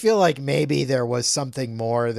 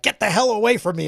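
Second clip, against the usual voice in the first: quick, raised imitation of a yell; mock yell; 7 semitones higher, 5 dB louder, much faster than usual. Retelling Adam pushing Farah away, mildly yelled. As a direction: retell his yell, higher, louder and rushed. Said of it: "he yells at her".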